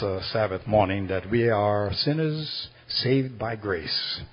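soft, low, measured praying voice; closing prayer. Real, not acted. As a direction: pray gently, pausing between phrases, sinking to amen. Leads congregation in closing prayer.

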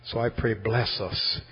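soft, low, measured praying voice; closing prayer. Real, not acted. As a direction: pray gently, pausing between phrases, sinking to amen. Leads congregation in closing prayer.

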